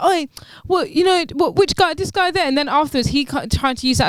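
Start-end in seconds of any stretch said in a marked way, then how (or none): 0.0-2.5 lightly voiced bystander, pitched higher; would-be rescuer. Imitating the other guy looking for the culprit, mildly confrontational. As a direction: act out an eager challenge, a bit higher. Acts out bystander offering to intervene.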